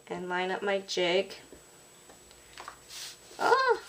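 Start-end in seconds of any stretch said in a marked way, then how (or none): 3.4-3.8 sudden high startled oh; surprised oh. Real, not acted. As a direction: caught off guard as the stamp fails. Stamp slips during demo.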